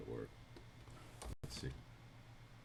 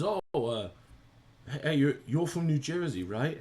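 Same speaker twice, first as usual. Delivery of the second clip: loud, higher-pitched retold question; Jazza; 8 semitones higher, 8 dB louder than usual. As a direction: imitate an eager guy checking where someone's from. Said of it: "It was Jazza when we did this Adobe stream".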